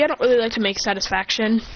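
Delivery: ordinary delivery, nothing marked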